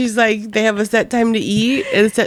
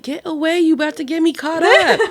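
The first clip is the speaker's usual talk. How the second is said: voiced cat; 8 semitones higher than usual. Voicing the cat, alarmed.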